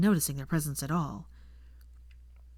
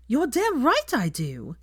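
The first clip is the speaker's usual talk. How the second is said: indignant; 7 semitones higher, 4 dB louder, slower than usual. Acted. Hermione insists she is furious.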